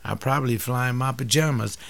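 normal talking voice

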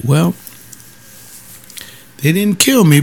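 ordinary talk, nothing marked

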